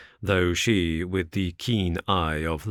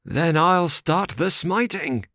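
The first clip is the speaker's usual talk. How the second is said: furious; 11 semitones higher than usual. Acted. Ferrier answering the suitors, higher and furious. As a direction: fire back at them. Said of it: "exclaimed Ferrier furiously".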